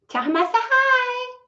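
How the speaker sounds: very high, squeaky cooing; cooing hello. Real, not acted. Sending a hi to a little one, high cooing.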